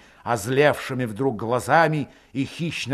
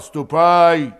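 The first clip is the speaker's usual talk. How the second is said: loud, raised, hostile threat; menacing promise; 5 semitones higher, 8 dB louder, slower than usual. A narrator's reading. Grigory shoving Valet out the door, menacing. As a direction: send him off with menace. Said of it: "недобрым голосом пообещал".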